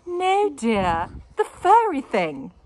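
high, loud insistence; insisting wife. A narrator's reading. Wife pointing out the furry thing, high. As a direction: insist patiently but firmly. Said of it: "insisted Missus Larrabee".

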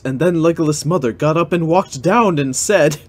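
ordinary delivery

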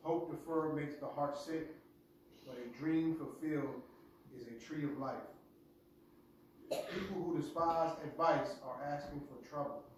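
normal talking voice

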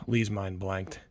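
plain reading voice

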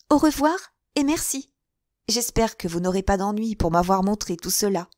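put-on a girl thanking him as she leaves; light grateful farewell; young woman, brightly polite. Girl's farewell.

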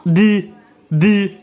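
ب said slowly as French "beu", stretched; slow, careful sounding out of ب; teacher drilling a syllable for a learner. Shown as a demonstration. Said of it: "وخديت ليه أو".

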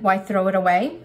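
ordinary talk, nothing marked

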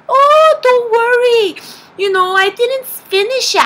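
high, loud, singsong reassurance; cheerfully soothing mom impression; imitated breezy mom. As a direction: brush it off in a high mock-mom voice. Mom breezily reassuring her daughter.

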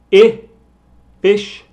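I said high and loud before fish; emphasized vowel. Shown as a demonstration. Teacher reviewing the vowels, loud and high. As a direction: punch the vowel. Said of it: "con énfasis en el vocal".

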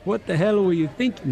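unconvincing British accent on a shouted-at-mom line; attempted British accent. Shown as a demonstration. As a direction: have a poor go at a British accent. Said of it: "Can you do a British accent?".

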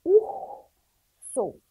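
"Urso" split in two, with a long breathy R after the U; R sound demo. Shown as a demonstration. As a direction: hold the aspirated R in "Urso", pause, then the last syllable. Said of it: "como o som da palavra fica diferente". ("Urso" com o R soprado e alongado, separando as sílabas)

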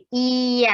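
"เอีย" stretched long, ee sliding into a; blended vowel demonstration. Shown as a demonstration. Teacher showing how two sounds combine, long gliding "เอีย". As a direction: blend ee and a into one drawn-out "เอีย". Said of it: "อีกับอานะคะ".

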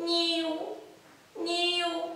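soft high squeaky nyiu; failed crow attempt. Shown as a demonstration. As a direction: try to crow and fail. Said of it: "kitörik a nyelvem".

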